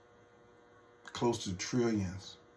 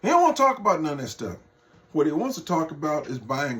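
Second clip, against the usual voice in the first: exasperated shout; 5 semitones higher, 6 dB louder, faster than usual. Real, not acted. Exasperated rant about Trump.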